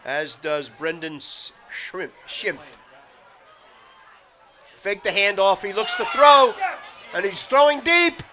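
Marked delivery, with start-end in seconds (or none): 4.8-8.2 building louder and higher into the throw; excited play call. Real, not acted. Live call of a deep pass.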